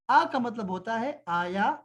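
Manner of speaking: ordinary talk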